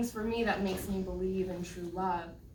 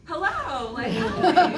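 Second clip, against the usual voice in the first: high, loud, startled hello; mock a flustered hello with a laugh; awkward surprised greeting; 8 semitones higher, 13 dB louder, much slower than usual. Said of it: "I'm like".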